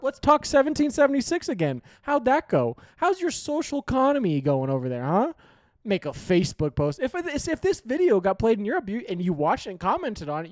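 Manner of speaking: loud, high mocking jabs; taunting shout. Real, not acted. Vlogger taunting Europeans with a loud mocking shout.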